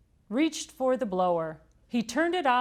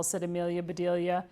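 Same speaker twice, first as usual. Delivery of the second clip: ordinary delivery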